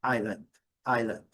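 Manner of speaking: island as EYE-land, silent S; stress on first syllable. Shown as a demonstration. Teacher models the strong first syllable, clear stressed demo. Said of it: "a primeira sílaba forte".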